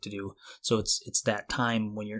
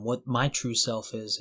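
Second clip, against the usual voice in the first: usual speaking voice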